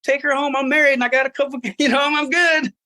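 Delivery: married traveler turning down an offered bride, flustered; awkward polite refusal. Retold or imitated. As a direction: squirm out of it politely. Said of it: "in the most awkward position".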